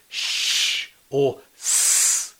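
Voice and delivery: Sh and s hissed alone, very slowly; isolated sounds. Shown as a demonstration. Preacher contrasting the two sounds for listeners, slow whisper. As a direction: hold the sh, then the s, whispered and drawn out. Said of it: "Did you hear the difference?".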